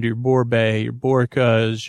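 usual speaking voice

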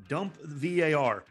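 usual speaking voice

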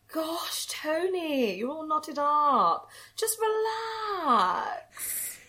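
Diana impression. Shown as a demonstration. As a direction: coo it gently and stretch the last words. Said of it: "give us a little bit of your Diana".

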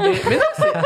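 high-pitched laugh breaking through the words. Real, not acted. Laughing as the friend doubts her joke. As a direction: she cracks up mid-sentence.

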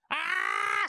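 high-pitched mock scream; mock yell. Friend imitates mom hollering from her car, mock yell. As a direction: let out a sudden high yell, imitating someone. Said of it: "She starts yelling".

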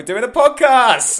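high, loud excited cheer; excited shout. Real, not acted. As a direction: shout it with glee. Excited podcast opening.

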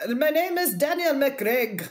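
slow, slightly lifted Irish lilt; attempted Irish accent. Shown as a demonstration. Cohost feeding a line to copy in an Irish accent. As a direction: put on an Irish accent, taking it slowly. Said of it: "I can't do an Irish accent".

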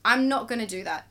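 emphatic refusal. Retold or imitated. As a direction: say no like you finally mean it. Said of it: "I was like".

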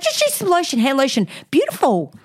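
high, airy gushing over the lotion; chirpy flatmate. Retold or imitated. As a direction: purr it like it's your own bathroom. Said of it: "Like, and she's".

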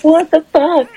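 high, loud disbelief; retold horrified shock. Woman jumping away from vomit, horrified.

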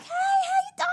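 very high, sing-out greeting; mock children's entertainer chirp; high-pitched happiness. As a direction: squeal a happy greeting to small children. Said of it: "a lot of high-pitched happiness".

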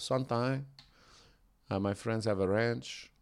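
put-on quiet Mexican-accented driver; soft-spoken Mexican accent. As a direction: imitate the cabbie's Mexican accent, softly. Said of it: "the quintessential Mexican accent".

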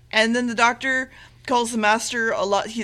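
normal talking voice

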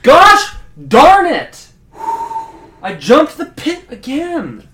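high, loud, drawn-out cry of frustration; anguished outburst. Acted. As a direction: wail it slowly in despair. Jumper despairs at disqualification.

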